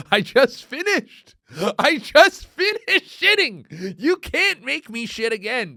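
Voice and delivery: high, loud comic outrage; worked-up mock despair; exasperated mock protest. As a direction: plead and protest at full pitch, slowly at first. Mock protest at his stomach.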